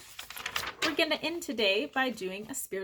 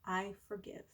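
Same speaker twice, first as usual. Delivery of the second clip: much softer I forgive, almost breathed; 9 dB quieter than usual. Shown as a demonstration. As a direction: let it fade out with the breath. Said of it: "Breathe out".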